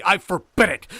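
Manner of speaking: loud, high, firm ban; angry command. A narrator's reading. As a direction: forbid it forcefully. Hildred forbids the marriage.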